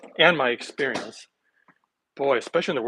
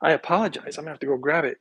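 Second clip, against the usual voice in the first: normal talking voice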